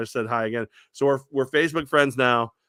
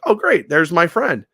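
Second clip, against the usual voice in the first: much higher and louder, delighted; excited recognition; 6 semitones higher, 8 dB louder than usual. Retold or imitated. Host retells spotting the fan, loud and delighted. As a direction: spot a friend across the room.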